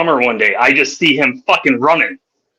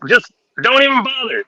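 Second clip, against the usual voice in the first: mocking taunt; 10 semitones higher than usual. Kid taunting fisherman.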